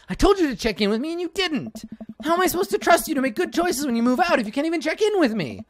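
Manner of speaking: high, heated parental lecture; exasperated lecture. Acted. A dad lectures his daughter for not checking in, pitched high and heated. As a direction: scold her, rising in pitch.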